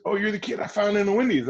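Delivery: surprised director. Retold or imitated. Director recognizes the kid.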